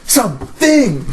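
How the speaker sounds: hammed-up high warning, slowing on the repeat; airplane movie monologue. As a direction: stretch the last words out dramatically. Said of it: "does the whole monologue from the airplane".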